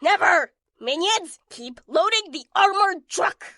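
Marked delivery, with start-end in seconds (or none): 0.0-0.5 loud defiant villain cry; defiant shout. Acted. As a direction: hurl it back defiantly. Villain defies heroes.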